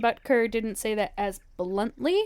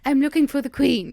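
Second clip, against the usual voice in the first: put-on British accent; 4 semitones higher, 8 dB louder than usual. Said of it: "you should talk like that".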